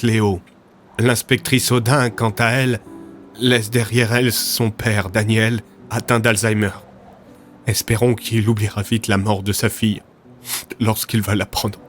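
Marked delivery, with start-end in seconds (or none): none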